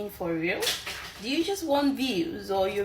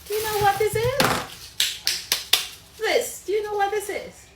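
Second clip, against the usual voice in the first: high, loud demand to know what this is; incredulous high shout; 11 semitones higher, 4 dB louder than usual. Real, not acted. Talking back to the fufu critic, high outraged shout. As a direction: shove the question at her, outraged.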